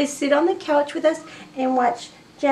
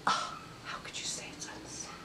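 rapid indignant whisper; fast whisper; 19 dB quieter, much faster than usual. Real, not acted. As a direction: hiss it quickly to the pets.

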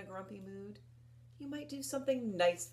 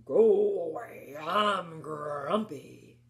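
grumpy voice; 3 semitones lower, 7 dB louder, much slower than usual. Acted. Grumpy character voice in story.

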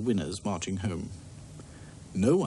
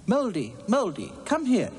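calling out high into the dark tunnel; shouting; 11 semitones higher than usual. Acted. Princess calling the monster down the tunnel, shouting. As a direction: call him out boldly, voice raised high. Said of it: "She shouted".

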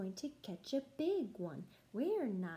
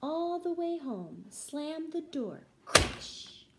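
excited dash; 5 semitones higher, 5 dB louder than usual. Acted. Fleeing home from lion.

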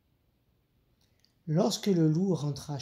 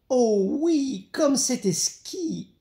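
high, drawn-out delight; gushing little rabbit; 8 semitones higher, 5 dB louder, much slower than usual. A narrator's reading. Little rabbit to his mother about soup, gushing delight. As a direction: gush slowly and high, savouring it. Rabbit now answers with exaggerated politeness.

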